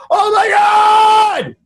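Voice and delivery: long, drawn-out high yell; hammed-up freak-out, very high and loud; mock panicked scream. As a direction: stretch it into a comic wail. Retold panic while being swung.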